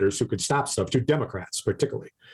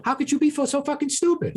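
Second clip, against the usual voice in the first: mock disbelief. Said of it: "And it was like".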